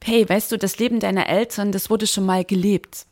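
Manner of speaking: ordinary talk, nothing marked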